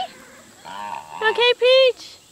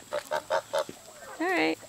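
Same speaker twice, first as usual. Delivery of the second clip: low soothing; 12 dB quieter, much slower than usual. Real, not acted. Soothing a scared bird.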